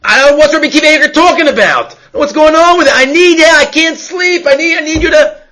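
high, fast, desperate pleading; frantic rebbe. Retold or imitated. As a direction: blurt it out in a sleepless panic. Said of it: "He's like".

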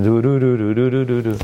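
mumbo-jumbo chant on one note; mock incantation. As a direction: rattle it off like a magic spell. Mimicking mindless recital of magical words.

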